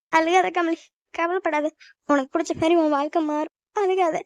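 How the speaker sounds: high, tearful comforting; crying along. Acted. Mother tells departing daughter not to cry, crying with her. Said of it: "நீ அவளோட சேர்ந்து அழுதுட்டு இருக்க".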